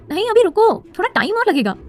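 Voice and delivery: a sister telling her brother to wait; younger sister Kirti. Acted. (छोटी बहन की ऊँची, तेज़ आवाज़)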